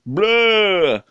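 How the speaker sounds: high, loud, drawn-out "blah"; big swooping nonsense cry. Real, not acted. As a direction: blurt out a long, silly blah. Exasperated noise breaking off the chat.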